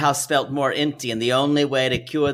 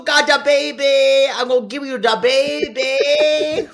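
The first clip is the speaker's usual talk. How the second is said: put-on Cajun accent mom; 10 semitones higher than usual. Voicing the fifty-three-year-old mom in a Cajun accent. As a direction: play the mother in a thick Cajun accent. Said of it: "Get used to that voice, y'all".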